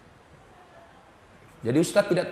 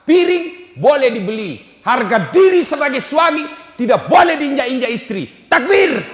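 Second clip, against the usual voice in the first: shouted, higher rallying cry; fiery preaching; 5 semitones higher, 6 dB louder than usual. Real, not acted. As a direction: preach it at full force. Preaching at full force. (berkhotbah dengan berapi-api)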